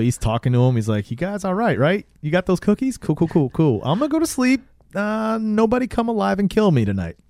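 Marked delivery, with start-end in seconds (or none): 1.1-7.2 high, uneasy mock-nervous voice; mildly freaked out. Ralph talking to the dolls at bedtime, mock nervous. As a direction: act nervously friendly, pitched way up. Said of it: "he's all freaked out by the dolls".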